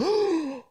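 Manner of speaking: sharp high mock gasp. Imitating viewers shocked by his statement, high gasp.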